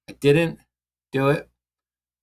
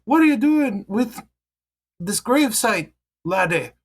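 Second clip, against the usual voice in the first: put-on old Danish lady demanding an answer, pitched high; Danish old lady Meryl; 8 semitones higher than usual. Meryl questioning the grave cleaner in a high Danish accent. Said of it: "Meryl says".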